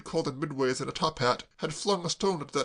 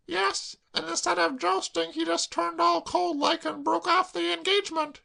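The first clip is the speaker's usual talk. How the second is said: put-on tearful girl. Claire sobbing to Braddock, high tearful wail.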